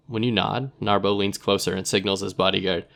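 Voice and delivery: ordinary delivery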